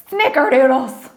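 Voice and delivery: loud southern "snickerdoodles" with a laugh; Southern cookie-curse exclamation; southern accent. Pretend. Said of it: "getting a southern accent doing this".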